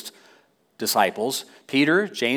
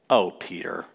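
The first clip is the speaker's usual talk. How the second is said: mock sigh; 7 semitones lower, 5 dB quieter than usual. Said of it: "that's my version".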